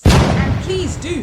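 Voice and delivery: loud, high and slow plea; exasperated loud pleading; emphatic plea. Acted. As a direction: beg them, stretching each word out. Pleading about table manners.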